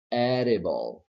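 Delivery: "edible" with a light tongue tap for the d; modelled "edible"; flap D. Shown as a demonstration. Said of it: "So we say".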